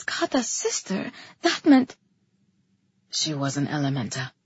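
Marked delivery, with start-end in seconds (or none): 3.1-4.3 very low, soft male voice; hushed low admission; under his breath. A narrator's reading. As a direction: murmur it, almost to yourself. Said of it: "nearly under his breath".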